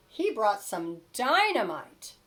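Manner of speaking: plain reading voice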